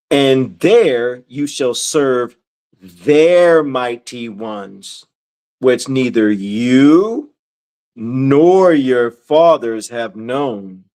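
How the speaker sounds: low, very slow scripture reading; deliberate re-reading. Acted. Teacher re-reading for newcomers to pay attention, low deliberate reading. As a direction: spell it out gravely, pausing between phrases. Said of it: "I'm gonna read this again. I want you to pay attention".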